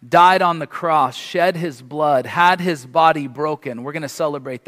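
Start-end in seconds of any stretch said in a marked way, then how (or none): none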